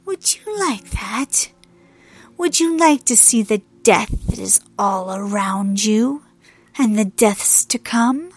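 loud, slow, whispery rasp; sneering death goddess. A narrator's reading. Hel leans closer to taunt her sister, sneering and menacing. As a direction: draw out the taunt like a looming corpse. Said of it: "Hel gave a sneering laugh".

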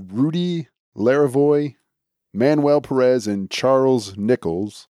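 usual speaking voice

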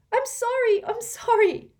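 high, loud frantic sorry; a girl crying out sorry to her hurt friend; panicked apology. A narrator's reading. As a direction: blurt the apology in a flurry. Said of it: "Hermione cried".

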